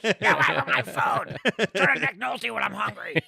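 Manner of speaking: put-on an old grump guarding his food; mock Nick Nolte.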